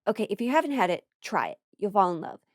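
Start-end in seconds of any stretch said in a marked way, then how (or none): none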